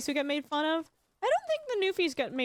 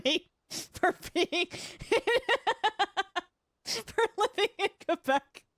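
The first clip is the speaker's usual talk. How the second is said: higher voice breaking into laughter; laughing while talking; 7 semitones higher than usual. Real, not acted. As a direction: struggle to talk through real laughter. Laughing at own joke about Chile.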